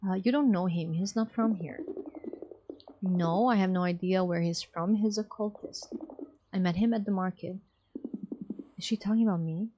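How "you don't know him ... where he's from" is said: normal talking voice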